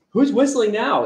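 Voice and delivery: mock baffled call; mock puzzled. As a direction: ask around in disbelief. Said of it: "You're like".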